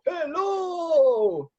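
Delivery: high, puppet greeting. Pretend. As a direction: give the puppet a high hello.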